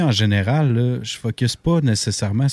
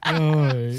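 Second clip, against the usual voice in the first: laughing; 6 semitones higher than usual. Real, not acted. Laughing while bragging about fish.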